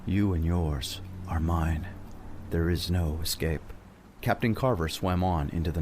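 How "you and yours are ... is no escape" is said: slow, eerie otherworldly voice; ghostly whisper. Acted. Ghost voice claims Carver and his crew, slow and eerie. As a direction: breathe it like a spirit at his ear. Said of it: "almost like a whisper in his ear".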